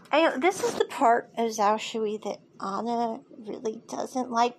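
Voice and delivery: normal talking voice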